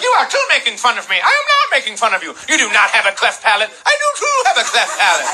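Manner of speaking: high-pitched indignant squabble with imitated impediment; hammed-up outraged back-and-forth; mock angry argument. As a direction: bicker hotly in cleft-palate voices. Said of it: "And she was so mad".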